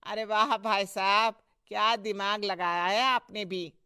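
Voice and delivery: lightly hammed-up flattery; admiring praise. Man praising husband's clever scheme, admiring.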